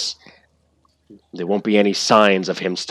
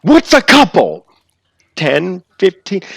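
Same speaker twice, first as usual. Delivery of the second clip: higher, loud incredulous demand; outraged disbelief; 9 semitones higher, 9 dB louder than usual. Acted. Outraged questioning.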